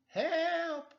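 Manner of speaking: high, loud yelp for help; cry for help. Acted. Stuck on the marquee, yelling down for help. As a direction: shout up from the sign.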